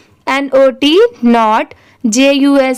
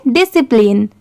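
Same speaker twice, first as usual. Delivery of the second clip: Discipline quickly, as one word; at speed. Shown as a demonstration. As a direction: run "di-si-plin" together in discipline. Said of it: "इसे speed में पढ़ेंगे".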